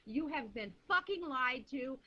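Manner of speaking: ordinary talk, nothing marked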